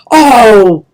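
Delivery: loud yelp of pain; yelled. Acted. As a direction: cry out as your tail is stamped on. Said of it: "he yelled".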